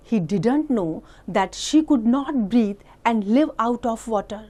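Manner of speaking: slow, deliberate read-aloud voice; story reading. A narrator's reading. Teacher reading an English story to the class, slow and clear. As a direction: read it out slowly for small children.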